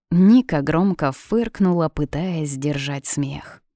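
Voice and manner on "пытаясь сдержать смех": ordinary delivery, nothing marked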